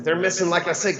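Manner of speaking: normal talking voice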